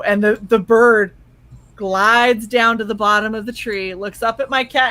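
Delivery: ordinary delivery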